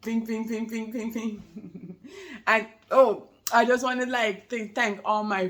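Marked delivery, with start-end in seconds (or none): 0.0-2.0 slow, sing-song ping noises; a mother mimicking a funny noise; playful ping noises. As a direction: draw out each ping like a little sound effect. Imitating sound again, slower.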